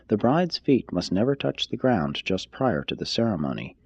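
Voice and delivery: normal talking voice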